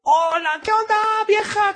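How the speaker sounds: sling a lazy hello at her; hammed-up offhand greeting; mock careless greeting. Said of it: "¿Así le saludas?".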